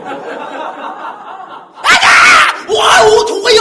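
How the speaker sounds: high loud fake Chinese shouting. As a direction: keep the mock Chinese yelling going. Continuing fake Jackie bit.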